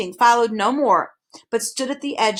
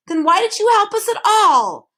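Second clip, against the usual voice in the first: much higher, louder angry demand; angry shout; 11 semitones higher, 7 dB louder than usual. A narrator's reading. Milo shouting at the tricky voice, angry. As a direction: shout at him in anger. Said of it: "shouted Milo angrily".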